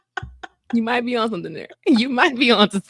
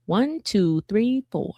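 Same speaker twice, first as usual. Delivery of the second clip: drawn-out, measured count of the numbers; retold slow, deliberate recitation; slow recital; much slower than usual. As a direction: spell it out slowly, digit by digit. Child calmly reciting the code.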